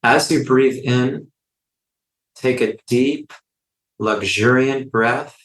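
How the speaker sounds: unhurried phrases with long silences between; guided meditation. Real, not acted. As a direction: lead listeners gently through each breath, in and out.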